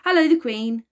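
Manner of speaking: grinning and cheerful; chirpy. A narrator's reading. Virgo greets the Queen with a big grin, chirpy. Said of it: "chirped Virgo with a big grin".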